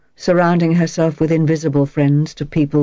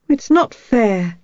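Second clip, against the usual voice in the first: rebellious; 5 semitones higher, slower than usual. A narrator's reading. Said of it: "Her voice was still rebellious".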